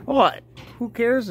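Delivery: ordinary delivery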